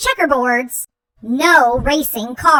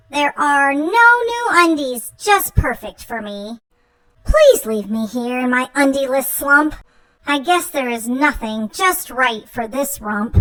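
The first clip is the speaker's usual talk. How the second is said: ordinary delivery, nothing marked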